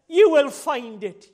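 slow, loud preacher's promise; ringing out from the pulpit; loud proclamation. Acted. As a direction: declare it forcefully, stretching the words. Preacher proclaims promise, then repeats softly.

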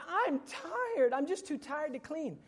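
whiny tired daughter. Retold or imitated. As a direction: play the daughter dodging chores. Daughter's excuse voiced by father.

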